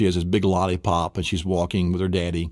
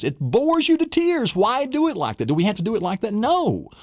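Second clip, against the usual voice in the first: excited; 12 semitones higher than usual. Real, not acted. Teacher mocking rote learning.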